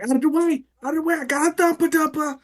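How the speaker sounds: high-pitched, frantic play-acted urgency; urgent bathroom rush. Player acting a dash for the stalls, high urgent cry. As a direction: push the pitch way up, clearing everyone aside. Acts rushing people from stalls.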